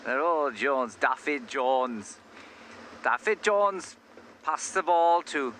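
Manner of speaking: slow, mock Welsh rugby commentary; Welsh accent. Presenter imagining a Welsh rugby side, hammed-up Welsh commentary. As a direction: call the passes in a put-on Welsh accent. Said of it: "a Welsh rugby side".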